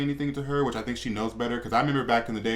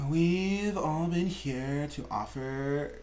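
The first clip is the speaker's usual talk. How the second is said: slow, higher, bored monotone drawl; Kardashians; 4 semitones higher, 4 dB quieter, much slower than usual. Shown as a demonstration. Host retrying a reality-star quote, slow bored monotone. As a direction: draw it out softly, perpetually bored with life. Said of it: "not a true Kardashian voice".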